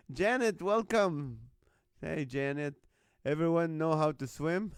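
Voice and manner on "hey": ordinary talk, nothing marked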